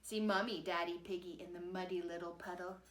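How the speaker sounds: ordinary delivery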